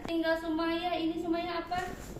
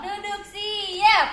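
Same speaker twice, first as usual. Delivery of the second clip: slow drawn-out command; 5 semitones higher, 8 dB louder, much slower than usual. Real, not acted. Teacher gives class command.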